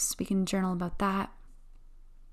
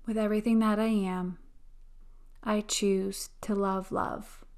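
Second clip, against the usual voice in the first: slow, calm affirmation voice; guided affirmations. Acted. Guided affirmation and breathing meditation.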